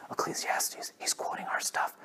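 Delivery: very soft whispered realization; stunned whisper. Retold or imitated. Viewers recognizing their own scripture, stunned whisper. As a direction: imitate onlookers whispering in surprise.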